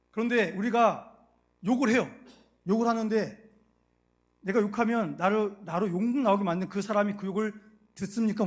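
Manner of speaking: loud, emphatic sermon voice; forceful preaching. Acted. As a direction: preach it with conviction. (힘주어 외치는 설교)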